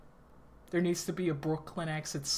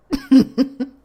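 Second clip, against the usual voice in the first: short loud burst of laughter; 8 semitones higher, 15 dB louder than usual. Real, not acted. Laughs again.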